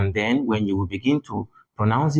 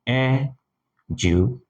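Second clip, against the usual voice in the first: education drawn out slowly, syllable by syllable; teacher counting the syllables in education slowly; 3 semitones higher, slower than usual. Shown as a demonstration. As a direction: spell out education slowly so the class can count. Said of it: "So count".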